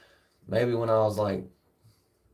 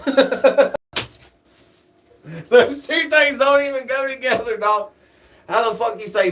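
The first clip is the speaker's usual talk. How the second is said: high, loud laughing talk; 10 semitones higher, 6 dB louder than usual. Real, not acted. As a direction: laugh through the words. Laughing at bald with long hair.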